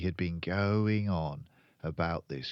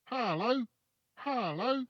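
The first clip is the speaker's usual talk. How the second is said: high parrot voice, rising each time; high repeated parrot greeting; calling parrot. A narrator's reading. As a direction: call like a parrot from up a chimney. Parrot calls from chimney.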